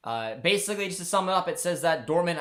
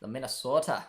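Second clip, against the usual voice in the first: brief attempt at a Minnesota accent; lightly imitated Minnesota accent; 3 semitones lower than usual. Shown as a demonstration. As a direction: put on a Minnesota accent for the place name. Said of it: "I was trying to say it there".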